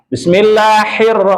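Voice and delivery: fast, hurried Bismillahirrahmanirrahim; hasty mistaken recitation; rushed wrong reading. Shown as a demonstration. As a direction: rush through it carelessly. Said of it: "itu sudah keliru".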